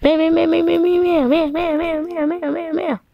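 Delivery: high, rapid cat meows; a cat yowling at the fire; cat meowing. Acted. Cat yowling at the fire, high-pitched meows.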